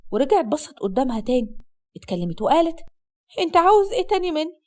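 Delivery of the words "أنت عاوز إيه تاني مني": tearful. Acted. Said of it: "وعينيها كانت كلها دموع".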